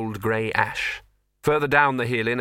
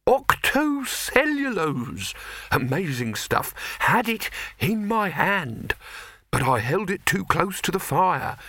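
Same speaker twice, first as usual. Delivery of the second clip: high, slow, dazed rambling; befuddled alchemist after a blast; perplexed, nearly concussed; 8 semitones higher, slower than usual. Acted. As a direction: wander through it like someone still seeing stars. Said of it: "in the perplexed tones of the nearly concussed".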